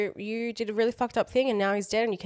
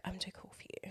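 whispered, aloof and superior; snobby whisper; 16 dB quieter than usual. Retold or imitated. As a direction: breathe it out like you're above everyone. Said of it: "like, air of".

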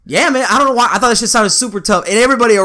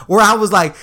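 ordinary delivery

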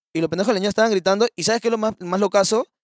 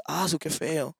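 indifferent mother; 2 semitones lower, 9 dB quieter, much slower than usual. Retold or imitated. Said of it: "Y me dice".

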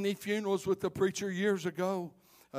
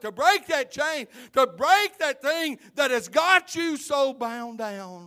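louder, higher, rising to a ringing call; preaching at full force; 5 semitones higher, 6 dB louder than usual. Real, not acted. Preacher urging his church, preaching at full force. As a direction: drive the gospel home to the church.

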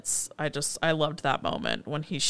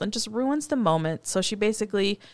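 ordinary talk, nothing marked

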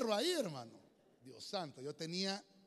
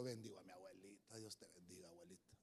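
much lower, soft, fading; tender blessing; 9 semitones lower, 11 dB quieter than usual. Retold or imitated. Preacher blessing his late grandmother, low and fading softly. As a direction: bless her softly, almost whispering. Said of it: "digo yo".

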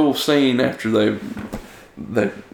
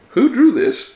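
pitched-up who drew this; high incredulous question; 14 semitones higher, 4 dB louder than usual. Retold or imitated. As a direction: ask it high and amazed. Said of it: "he's like".

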